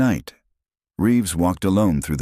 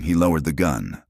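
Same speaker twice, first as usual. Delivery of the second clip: usual speaking voice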